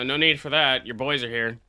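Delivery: normal talking voice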